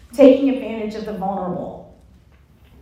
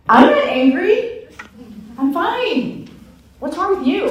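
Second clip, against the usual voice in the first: mock angry denial; 9 semitones higher, 7 dB louder than usual. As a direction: snap back defensively, hammed-up. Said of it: "what do you think my response is".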